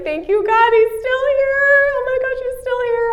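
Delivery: put-on mother finding her lost toddler, crying with relief; relieved crying. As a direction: weep out the thanks.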